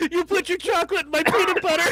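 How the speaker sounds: high laughing ad quote. Pretend. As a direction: laugh through the line.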